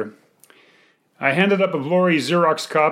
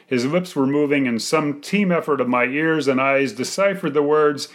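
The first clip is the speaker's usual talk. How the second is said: ordinary delivery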